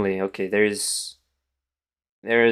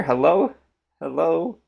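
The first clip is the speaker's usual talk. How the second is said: incredulous hello; 7 semitones higher than usual. Real, not acted. Stuck in a losing chess position.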